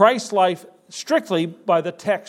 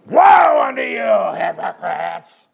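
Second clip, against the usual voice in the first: loud, slow condemning shout of woe; letting them have it; 3 semitones higher, 6 dB louder, slower than usual. Retold or imitated. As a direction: thunder the woe at them, stretching every word. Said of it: "He's letting them have it".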